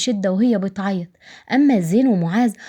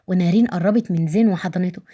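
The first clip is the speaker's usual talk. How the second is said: plain reading voice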